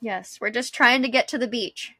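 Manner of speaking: ordinary delivery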